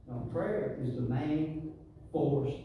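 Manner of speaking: very slow, weighty delivery; drawn-out emphasis. Real, not acted. Preacher driving home the power of prayer, very slow. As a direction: stretch each word for weight.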